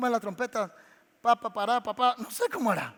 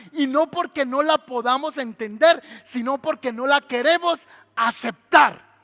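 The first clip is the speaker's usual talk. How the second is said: building, louder emphatic proclamations; full preaching; 5 dB louder than usual. Acted. Preacher proclaiming to the congregation, loud and emphatic. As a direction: drive each line home from the pulpit.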